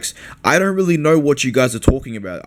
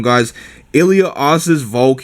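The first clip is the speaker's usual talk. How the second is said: usual speaking voice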